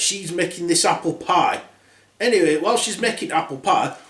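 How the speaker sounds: normal talking voice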